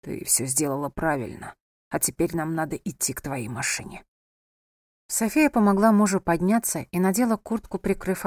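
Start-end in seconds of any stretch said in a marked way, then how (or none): 0.0-4.0 low, soft male character voice; gentle reassurance. A narrator's reading. Wounded husband reassures his wife, gentle low voice. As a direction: reassure her with little strength left.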